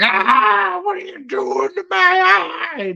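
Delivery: high, strained scream of pain; mock pained yell. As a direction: screech it like your eye is being pulled out. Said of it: "Not like".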